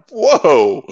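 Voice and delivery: high, loud mock-astonished exclamation; recounted burst of amazement; stunned reaction. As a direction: blurt it out as if taken aback. Acts out startled reaction to insults.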